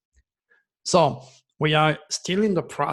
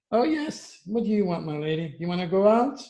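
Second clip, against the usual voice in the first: high, sing-song puppy talk; pet talk; 4 semitones higher than usual. Real, not acted. Talking sweetly to his puppy.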